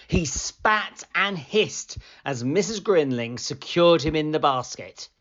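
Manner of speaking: ordinary delivery, nothing marked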